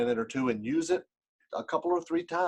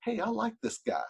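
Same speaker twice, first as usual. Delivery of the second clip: inner thought voice; 9 semitones higher, 4 dB quieter than usual. Retold or imitated. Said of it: "But what they do know is".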